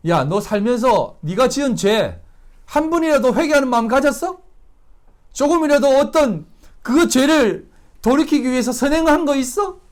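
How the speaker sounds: acted angry grilling, building to a loud dismissal; harsh scolding. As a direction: scold him loudly, then dismiss him sharply. Imagined Jesus scolding and rejecting robber.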